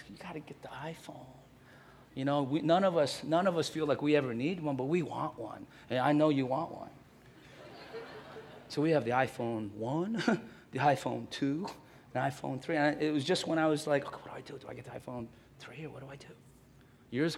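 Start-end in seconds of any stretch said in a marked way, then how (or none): 0.1-1.2 whisper. Retold or imitated. Friends urging in whisper. 14.0-16.3 hushed wondering. Retold or imitated. Pastor wondering to himself.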